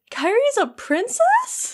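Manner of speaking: cute squeaky disbelief; adorable baffled Sora. Retold or imitated. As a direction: squeak it out like a stunned little kid. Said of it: "very adorably is like".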